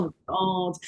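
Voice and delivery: low, soft groan of disgust; exasperated groan. Acted. Commentator reacting to the presenter's cool act, exasperated groan.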